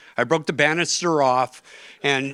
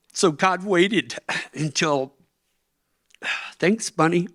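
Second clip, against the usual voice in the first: choked up; 5 semitones higher than usual. Real, not acted. Coach on a podcast, moved by the memory: choked up. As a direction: voice catching on the memory. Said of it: "after that emotional crying".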